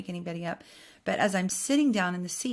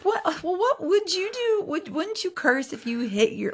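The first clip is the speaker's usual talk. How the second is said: high-pitched, exasperated mock challenge; indignant retort; 11 semitones higher than usual. Imagined comeback to the judgmental seatmate about cursing, indignant. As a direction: throw the question back, high and indignant.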